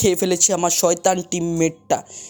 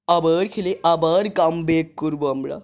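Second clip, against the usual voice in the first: ordinary talk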